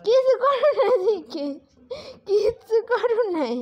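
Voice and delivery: high laughing tease with a catch of tears; laughing teasingly. Real, not acted. Teasing baby, laugh-cry.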